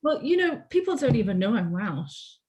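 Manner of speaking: usual speaking voice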